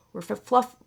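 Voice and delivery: tongue-tied. Real, not acted. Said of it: "I can't even say that".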